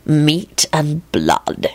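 very slow, menacing relish; hushed ominous satisfaction; ominously satisfied. A narrator's reading. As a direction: savour each word darkly and slowly. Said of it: "in an ominously satisfied voice".

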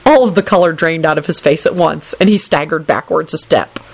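usual speaking voice

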